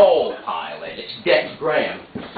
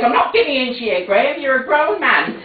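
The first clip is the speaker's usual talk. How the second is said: mother's voice; 11 semitones higher, 5 dB louder than usual. Acted. Mother scolds grown son.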